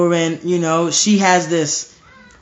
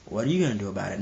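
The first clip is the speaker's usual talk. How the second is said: low, quiet bully's dare; dismissive bully; 6 semitones lower, 6 dB quieter, much faster than usual. Acted. Big bully answering a little kid, low dismissive dare. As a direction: drop low and soft, daring the kid. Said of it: "the bully just stands up and says".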